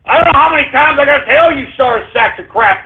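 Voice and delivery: high, screamed tirade; enraged outburst. Real, not acted. Host raging at trolls' plot jibe, enraged yelling. Rages at antisemitic username.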